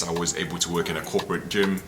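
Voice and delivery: ordinary talk, nothing marked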